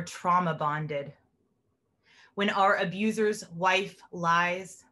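slow, measured poem reading; poetry reading. Acted. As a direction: let each image land with a pause. Reads her own poem.

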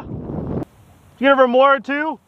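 ordinary delivery

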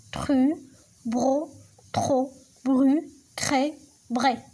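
each syllable said clearly on its own; slow, clear syllable drill. Shown as a demonstration. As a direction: model the sounds one by one for pupils. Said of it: "نعاودوهم".

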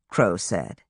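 usual speaking voice

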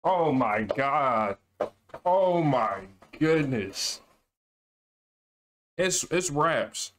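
slow, drawn-out disbelief; overwhelmed by what he just heard; stunned awe. Real, not acted. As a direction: let each word hang in amazement. Stunned by the diss lyrics.

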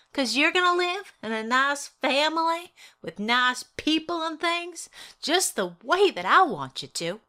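loud, earnest mother pleading; mother's voice. Acted. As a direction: speak firmly to your child. Mother voiced addressing her child.

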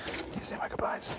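breathy whispered repeat of I need to say my goodbyes; urgent whispered repeat. Shown as a demonstration. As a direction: say it again as a hushed whisper.

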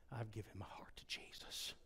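tender whispered quote of his father; softly whispered father's words. Retold or imitated. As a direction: whisper it like the sweetest news. Father's quiet confession on phone.

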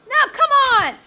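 high, loud burst of frustration; exasperated shout. Real, not acted. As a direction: protest loudly at the game. Frustrated at the game.

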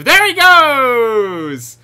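loud, very drawn-out cheer; long high whoop of triumph; elated shout. Real, not acted. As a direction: holler as it takes flight, stretching GOES. Bus launches off the hedge.

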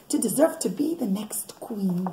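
hushed storyteller whisper. A narrator's reading. Mother whispering the story to her kids. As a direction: lean in and whisper it like a secret.

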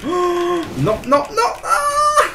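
very high squealed no's ending in a scream; panicked cries. Real, not acted. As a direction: squeal in panic as you lose the fight. About to lose a life in game. (cris de panique très aigus)

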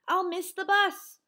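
loud, high cry of alarm; panicked cry. A narrator's reading. Froggy sees he'll miss the bus, panicked cry. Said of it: "he cried".

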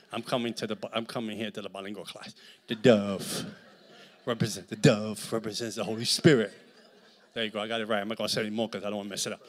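ordinary delivery

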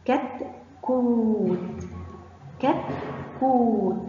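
short first syllable, then a long held oo in كتكوت. Shown as a demonstration. Teacher sounds out a word for children, long oo in كتكوت. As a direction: sound out كتكوت slowly, stretching the oo. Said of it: "مد بالواو".

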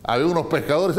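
ordinary delivery

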